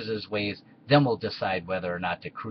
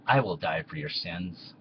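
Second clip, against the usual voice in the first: usual speaking voice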